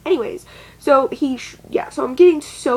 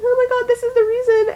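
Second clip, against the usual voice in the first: much higher, fast exasperated whine; a high mocking teen voice explaining herself; whiny pleading teen; 12 semitones higher, faster than usual. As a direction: play your desperate teenage self. Imitating her younger pleading self.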